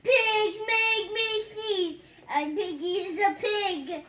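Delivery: high, loud and slow, held-out words; character voice. Acted. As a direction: complain in a big slow whiny voice. Voicing the sneezy character.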